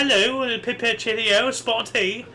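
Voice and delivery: hammed-up, high British caricature; cartoonishly chirpy British English; mock English accent. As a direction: put on a silly, over-the-top English accent. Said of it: "not just, like, you know, us English".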